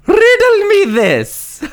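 high, slow, hammed-up villain taunt; Riddler-style line. Mock villain teasing his co-host. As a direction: stretch it out like a cartoon riddler.

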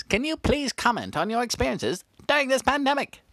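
normal talking voice

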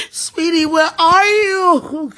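slow, loud call through laughing sobs; wailing call. Real, not acted. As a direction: cry out for her in grief. Calling out to lost loved one.

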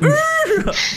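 very high, loud frightened squeal; shriek. Acted. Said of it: "He's like".

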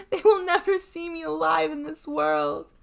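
high, loud despairing lament; sobbed. A narrator's reading. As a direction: wail it to your sister as if doomed. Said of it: "sobbed Fatima".

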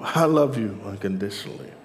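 low, softened voice of God; tender reassurance. Acted. God reassures doubters that they are loved, tenderly. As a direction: drop low and gently reassure them. God's reply to doubting believers.

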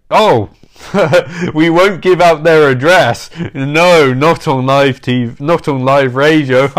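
usual speaking voice

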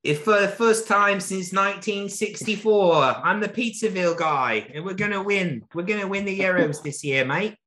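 high-pitched mock English football fan; English accent. Hyped fan predicting England will win the Euros, high English accent. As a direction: put on an excitable English accent. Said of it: "I can do the English one".

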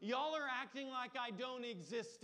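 little Piper finally yelling at her brothers; little girl yelling. Retold or imitated. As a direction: shout it like a fed-up little girl. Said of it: "She just yelled".

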